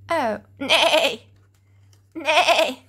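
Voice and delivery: loud neighing horse call. Retold or imitated. Showing children what the horse says, loud neigh. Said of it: "This is the horse".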